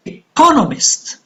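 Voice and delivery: "Economist" high on "con", then falling; stress on second syllable. Shown as a demonstration. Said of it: "on the second".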